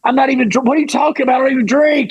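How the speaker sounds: high, baffled, scrambling for words; sputtering, high-pitched objection; incredulous protest. Retold or imitated. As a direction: push back in stunned disbelief. Protesting the offered shot.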